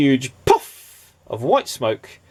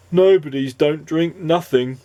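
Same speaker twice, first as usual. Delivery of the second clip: glum Nobody; 5 semitones higher than usual. Acted.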